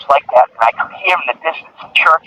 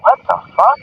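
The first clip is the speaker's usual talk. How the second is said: surprised; 10 semitones higher than usual. Retold or imitated. Hears sirens coming closer.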